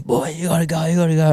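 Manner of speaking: urgent warning. Retold or imitated. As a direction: imitate a guard hurrying people out. Guard runs in to evict them.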